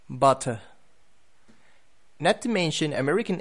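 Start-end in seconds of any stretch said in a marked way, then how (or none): 0.1-0.6 "butter" the British way, crisp T, silent final R, falling; British demo of "butter" with a closing fall; British accent. Shown as a demonstration. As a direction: model British "butter", ending in an open uh. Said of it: "it sounds just like a T".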